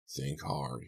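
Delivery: ordinary delivery, nothing marked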